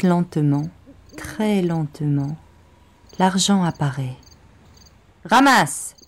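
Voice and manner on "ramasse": harsh order. Acted. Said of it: "répète le rouquin".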